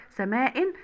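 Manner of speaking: سماء ending in -in; تنوين بالكسر. Shown as a demonstration. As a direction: sound out سماء with a final -in. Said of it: "بالتنوين بالكسر". (نطق سماء بكسرتين في آخرها)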